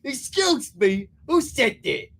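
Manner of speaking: high, loud indignant yell. Acted. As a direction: yell it like an outraged janitor. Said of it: "yelled Mister Norris".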